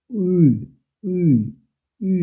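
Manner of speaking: উ said with lips rounded, ü; rounded ü drill. Shown as a demonstration. Teacher drilling ü for students, rounded lips. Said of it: "ঠোঁট গোল করে".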